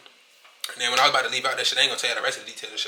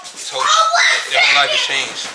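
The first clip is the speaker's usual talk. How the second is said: much louder, forceful shout; emphatic shout; 2 semitones higher, 11 dB louder than usual. Real, not acted. Reflecting on inmates' choices, shouting for emphasis. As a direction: drive the point home at full volume.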